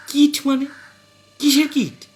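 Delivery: wife grilling her cagey husband; suspicious frown. Acted. Wife interrogates husband about the kit, high and suspicious. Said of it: "তিনি ভুরু ব্যাপক কুঁচকে বললেন".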